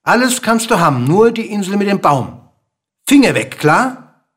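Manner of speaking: high, loud, insistent bird; sharp warning. A narrator's reading. Bird warns king off the island. (scharf und nachdrücklich warnend)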